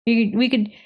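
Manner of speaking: usual speaking voice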